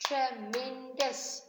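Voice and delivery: "Tremendous" slowly in three separate beats; syllable by syllable. Shown as a demonstration. Teacher counting syllables for the class, "Tremendous" broken syllable by syllable. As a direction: clap out "Tremendous", each syllable on its own. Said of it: "can you clap with me".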